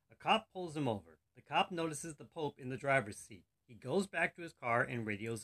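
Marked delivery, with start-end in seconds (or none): none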